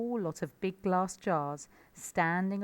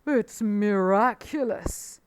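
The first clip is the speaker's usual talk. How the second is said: plain reading voice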